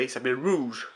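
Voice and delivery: "Rouge" said with an English-style R; English accent. Shown as a demonstration. Showing viewers how the English say the name, English accent. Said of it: "l'accent anglais".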